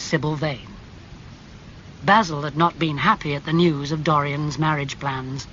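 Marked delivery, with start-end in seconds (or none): none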